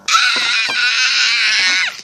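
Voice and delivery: sharp crying yelp; short scream. Real, not acted. Child cries out at her sibling bugging her. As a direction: let out a startled cry.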